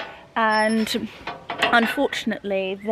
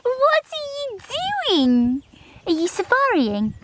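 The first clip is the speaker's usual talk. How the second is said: dog voice; 14 semitones higher than usual. Real, not acted. Owner talking to her dachshund in high dog voice. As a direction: coo at the dog in a silly high voice. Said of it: "I am so sorry for my dog voice".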